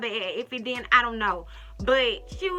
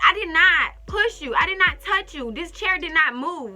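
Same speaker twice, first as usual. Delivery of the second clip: heated denial; 5 semitones higher, 5 dB louder than usual. Retold or imitated. Defending herself in the argument.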